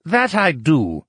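a smug little scientist answering; scientist character voice. A narrator's reading. Lucian curtly answering his rival on the street, haughty. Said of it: "the scientist replied".

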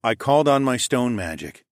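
plain reading voice

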